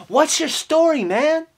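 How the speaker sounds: usual speaking voice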